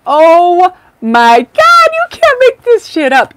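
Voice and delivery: high, loud, slow cry of delight; excited disbelief. Real, not acted. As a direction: gush at the cards in real amazement. Excited reaction to cards.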